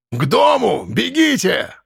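high urgent yell; shouting over wind. A narrator's reading. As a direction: shout them toward the house. Архип гонит всех от бури. (крик сквозь бурю)

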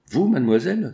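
shocked outcry at her offer; surprised cry. A narrator's reading. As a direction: blurt it out in alarm. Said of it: "s'écria Mériadec".

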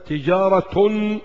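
تجارة read slowly as tijaratun; slow demo of تجارة ending in -tun; tanween with dammatayn. Shown as a demonstration. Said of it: "ضمتين".

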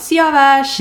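high, loud imitation of a call. Recalling how they called after a colleague, high mock shout. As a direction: re-enact calling after someone. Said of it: "jag också har ropat".

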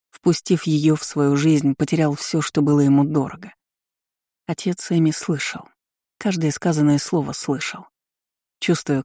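ordinary delivery, nothing marked

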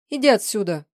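loud, fierce dismissal; angry. A narrator's reading. Woman sending away the man who grabbed her, angry. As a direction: order him away with force. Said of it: "Она зло вырвалась".

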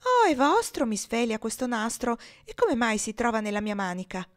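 high, wide-eyed surprise, easing down; wondering innocence. Acted. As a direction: feign carefree amazement, starting high and loud. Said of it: "con aria di maraviglia e di incurante innocenza".

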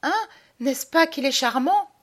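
high, eager woman's voice; proud fiancée. Acted. Madelon asks approval of her lapdog, proud and high-pitched. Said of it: "elle me montre toute fière".

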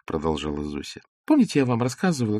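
usual speaking voice

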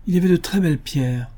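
high, sorry lament; distressed. A narrator's reading. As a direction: mourn the lovely thing lost. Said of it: "désolée".

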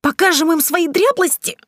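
very high, alarmed question; shrill, disbelieving squeak; squeaky incredulity. A narrator's reading.

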